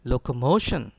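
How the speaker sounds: locomotion with a loud, stressed MO; firm locomotion, falling after MO; model pronunciation. Shown as a demonstration. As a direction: hit MO in locomotion for the class. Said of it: "Once again".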